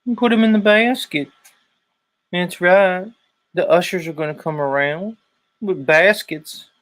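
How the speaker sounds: hammed-up collection-plate pitch in a Southern accent; Southern preacher grift. Preacher urging the pews to give, mock Southern sermon.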